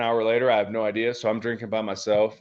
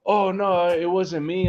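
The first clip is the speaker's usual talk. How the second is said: a touch of scared pleading, pitched higher; mock scared; 5 semitones higher, slower than usual. Cornered guy in the bathroom pleads with him, mildly scared. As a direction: imitate a frightened guy denying it. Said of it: "he was all scared".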